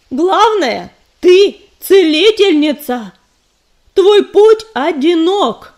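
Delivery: loud, slow stern scolding. A narrator's reading. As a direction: lay down the law loudly. Mentor lectures angrily.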